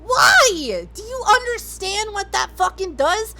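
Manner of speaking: ordinary talk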